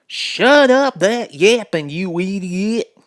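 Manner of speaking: loud, slow, high cowboy holler; bellowing cowboy. Acted. Cowboy yells at the yapping dog, bellowing. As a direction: yell it out the door at the noise. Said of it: "stuck his head out the door and bellered".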